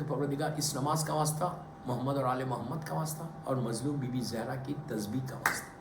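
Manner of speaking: low, soft pleading prayer; quiet supplication. Acted.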